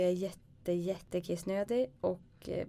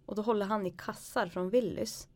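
usual speaking voice

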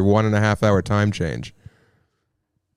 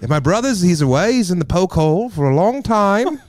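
higher-pitched mock Australian guy; hammed-up Aussie storyteller, pitched up; imitating Zane; 7 semitones higher than usual. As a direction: play a local bloke spinning his tale. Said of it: "This guy Zane was like".

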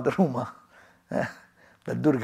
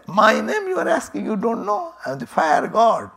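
higher, haughty boast of the fire god; proud indignant boast; 7 semitones higher than usual. Acted. Fire god boasts to the stranger, haughty and high. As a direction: puff yourself up, offended not to be known.

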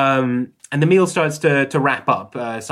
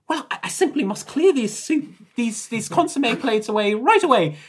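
high, louder, stammering nurse voice; flustered nurse; 13 semitones higher, 6 dB louder than usual. Acted. Nurse escaping questions to clear plates, high and flustered.

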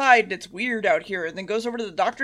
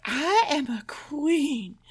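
drawn-out, higher-pitched indignant queen; pissy queen; 5 semitones higher, 4 dB quieter, much slower than usual. Retold or imitated. Recapper voices the Queen refusing servanthood, mock-indignant. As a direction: stretch it out in haughty mock offence. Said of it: "she gets all pissy".

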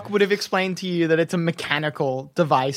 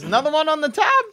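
high, quick gnome voice with a wink; sly gnome barkeep; 8 semitones higher, faster than usual. Acted. Gnome barkeep winks at Rowan about the tab, sly high voice. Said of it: "winks at you, Rowan, and says".

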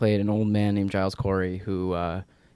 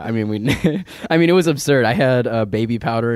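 laughing loudly while recalling; cracking up at the absurd memory; loud real laughter through the words; 4 semitones higher, 10 dB louder than usual. Real, not acted.

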